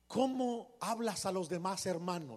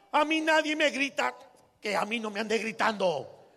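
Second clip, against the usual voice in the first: loud, higher indignant protest; mock-angry rich man; offended outburst; 3 semitones higher, 4 dB louder than usual. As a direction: bristle at the insult. Offended husband retorts.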